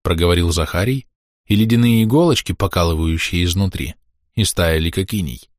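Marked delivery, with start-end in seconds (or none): none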